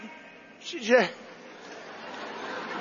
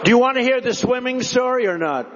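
ordinary delivery

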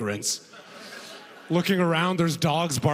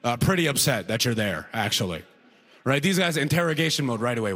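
normal talking voice

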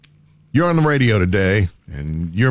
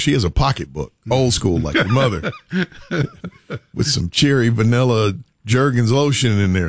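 ordinary talk, nothing marked

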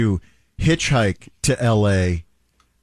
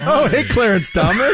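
loud, high put-on celebrity voice; Clarence Thomas; 9 semitones higher, 5 dB louder than usual. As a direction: shout it out as a goofy impression. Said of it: "That's definitely not how Clarence Thomas speaks".

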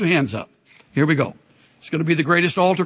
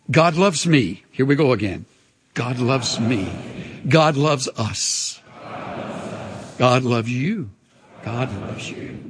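gentle repeated affirmation; 4 semitones lower, 3 dB quieter than usual. Acted. Leading congregation repetition.

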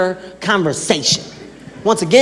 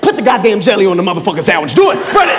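hammed-up shouted command; mock angry order. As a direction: bark it out like a crazy uncle. Uncle's crazy barked order.

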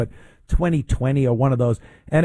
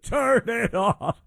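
laughing; 6 semitones higher, 3 dB quieter, slower than usual. Real, not acted. Laughing after shouting.

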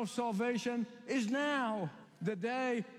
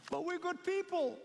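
much higher, begging tone; pleading foolish ones; 9 semitones higher than usual. Acted. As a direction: plead desperately at the closed door.

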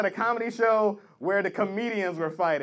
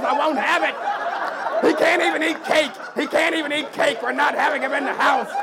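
loud outraged dad shouting; mock furious father; 4 semitones higher, 5 dB louder than usual. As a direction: forbid it with hammed-up rage. Father raging about daughter's boyfriend.

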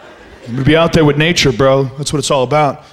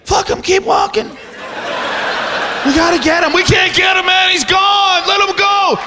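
a high, half-shouted hurry; mock yell to keep walking; callous climber; 7 semitones higher than usual. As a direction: push them on, leave him behind. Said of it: "you're like".

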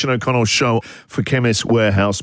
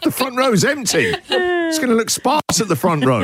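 hammed-up whiny lament, higher; mock distress; 9 semitones higher than usual. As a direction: overplay the sulking host. Mock distress at empty row.

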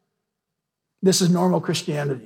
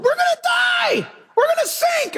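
much higher, squealing panic; panicked squeal. Retold or imitated. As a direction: shriek like terrified sailors. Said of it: "All the guys are crying in the boat".

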